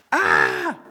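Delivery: short low startled yell; a sudden frightened cry; ghost scare yelp. Pretend. Terrified disciples seeing a ghost, startled yelp.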